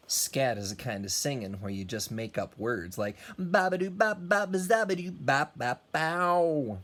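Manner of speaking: normal talking voice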